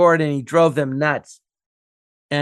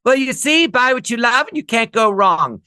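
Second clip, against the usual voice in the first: high-pitched, pompous sketch-character voice; idiot tycoon; 6 semitones higher than usual. Retold or imitated. Mister Canby advising his businessmen, high sketch-character voice. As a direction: deliver advice as the oblivious rich idiot. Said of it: "he was an idiot".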